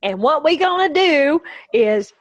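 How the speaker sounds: folksy Southern brain surgeon, hammed up; Jeff Foxworthy. Comedian playing a surgeon before operating, mock Southern accent. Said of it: "have you ever heard Jeff Foxworthy go".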